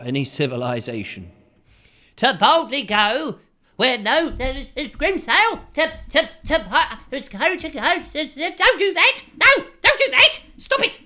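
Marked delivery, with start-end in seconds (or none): 2.2-8.6 high, loud stuttering stuck announcer; stuck mock announcer. Host parodies the Star Trek intro getting stuck, high and loud. 8.6-11.0 very high, loud squealing shout; mock squealing protest. Host shrieks at an imagined pest to stop it, high and loud.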